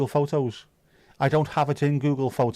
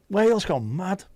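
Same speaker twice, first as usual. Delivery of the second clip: higher, swooping up on "mad"; playful exclamation; 7 semitones higher than usual. Pretend. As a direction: play up "Wales gone mad" with a rising lilt. Playing up the misheard phrase.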